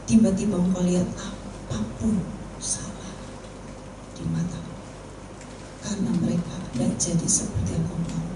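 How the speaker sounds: gentle counsel. Real, not acted. Counselling the weeping teacher.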